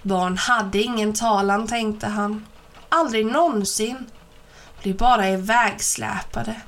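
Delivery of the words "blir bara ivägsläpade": ordinary delivery, nothing marked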